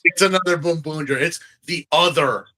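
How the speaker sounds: usual speaking voice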